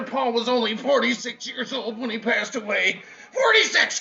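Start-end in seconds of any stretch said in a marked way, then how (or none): 3.3-4.0 high, loud cry of the number; emphatic exclamation. Real, not acted. Grieving brother telling the crowd, emphatic cry. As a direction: punch it out in disbelief. Stunned repeat of brother's age.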